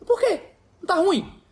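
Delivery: playing innocent to provoke; mock surprise. Pastor feigning surprise in story. (surpresa fingida bem aguda)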